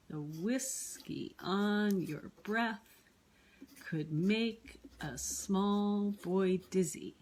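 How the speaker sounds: slow, exaggerated da-DUM beat: the WHISkey ON your BREATH, could MAKE a SMALL boy DIZzy; scanning the meter. Shown as a demonstration. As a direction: stretch every stressed syllable, WHIS, ON, BREATH, MAKE, SMALL, DIZ. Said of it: "If we wanted to scan this poem".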